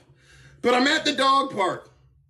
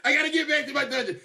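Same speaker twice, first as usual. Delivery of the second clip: hurried quote; 5 semitones higher, much faster than usual. Retold or imitated. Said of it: "I told".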